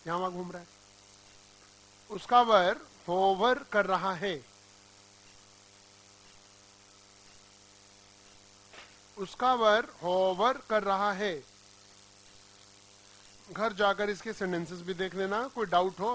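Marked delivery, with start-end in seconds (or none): 2.1-11.4 slow, clear dictation with stress on उसका; teacher dictates उसका वर hover कर रहा है slowly. Shown as a demonstration. Teacher dictating a sentence to the class, slow and clear.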